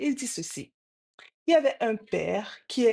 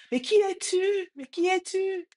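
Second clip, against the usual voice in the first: imitated distant echo; 11 semitones higher than usual. Distant voice answers the boy back, imitated echo. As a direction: echo the question back twice, pitched up. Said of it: "répond encore de loin".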